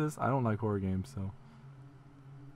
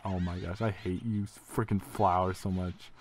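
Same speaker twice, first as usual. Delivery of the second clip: ordinary talk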